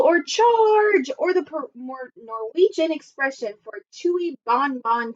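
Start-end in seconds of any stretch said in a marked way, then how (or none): 0.2-1.1 high, loud charge cry; a shouted, high-pitched charge; dinosaur battle cry. Acted. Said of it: "trio of dinosaurs yelling".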